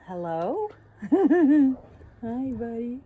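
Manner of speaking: high sweet puppy voice with a laugh; puppy talk. Real, not acted. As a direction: coo at the dog. Greeting the puppy fondly.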